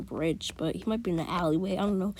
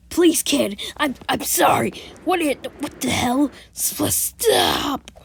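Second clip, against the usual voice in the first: higher, begging voice; pleading villain; 5 semitones higher, 3 dB louder, slower than usual. Retold or imitated. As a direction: beg the kid to stop. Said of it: "it could be like".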